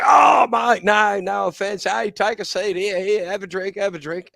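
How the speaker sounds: high, loud, flustered backpedalling that fades off; mock-panicked sailors falling over themselves to apologize; apologetic American sailors. Storyteller voicing sailors apologizing to the boyfriend, high and loud. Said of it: "And they".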